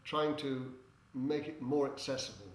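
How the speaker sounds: normal talking voice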